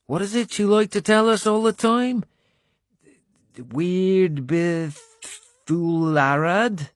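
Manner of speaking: Irish lilt tripping over the Saxon words; Irish accent. A narrator's reading. Irish friend teasing his lord with his own saying, in Irish accent. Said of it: "His Irish accent mangled the words".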